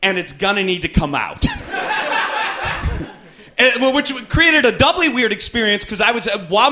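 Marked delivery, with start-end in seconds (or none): 0.0-1.4 receptionist voice. Retold or imitated. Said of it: "She then goes". 1.5-3.1 high laugh. Real, not acted. Laughing at own bit.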